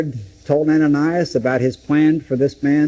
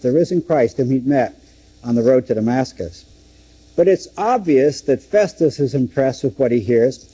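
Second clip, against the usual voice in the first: ordinary talk, nothing marked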